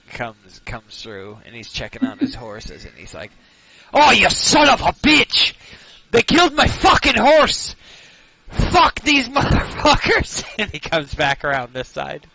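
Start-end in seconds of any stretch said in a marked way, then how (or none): 3.9-10.4 furious shout. Acted. NPC furious over dead horse.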